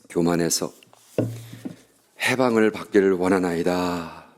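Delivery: slow, hushed prayer voice; unhurried pastor's closing prayer. Acted. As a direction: pray it humbly and slowly.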